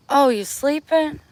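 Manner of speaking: high, loud questioning call. Retold or imitated. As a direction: call out as if she is asleep. Dad calling for her.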